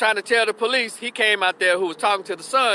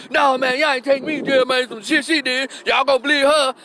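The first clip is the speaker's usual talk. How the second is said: protesting man; 6 semitones higher than usual. Retold or imitated. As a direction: refuse to go to jail. Boyfriend protesting, raised voice.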